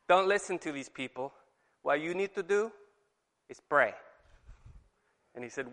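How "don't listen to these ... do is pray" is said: light hint of a Filipino accent; Joselito Melgar's Filipino accent. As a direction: quote him with a slight Filipino accent. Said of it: "in his very thick Filipino accent".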